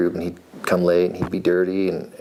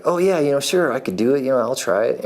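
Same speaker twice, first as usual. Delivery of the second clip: higher, eager, keen to try; eager yes; 6 semitones higher than usual. Retold or imitated. Telling the old farrier he'd like to try, eager and pitched up. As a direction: jump at the offer, pitched up. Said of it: "And I was like".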